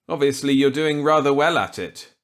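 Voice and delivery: put-on eager reply to an old colleague. Walker accepting Millet's gambit eagerly.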